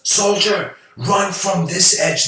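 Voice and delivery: high, loud mock military order; shouted command. General orders his soldier toward the cliff edge, high loud command.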